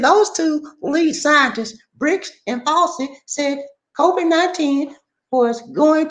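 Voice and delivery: slow high Gomer Pyle voice; Gomer Pyle talk. Pretend. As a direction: deliver the news as Gomer would. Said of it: "Can I talk Gomer Pyle".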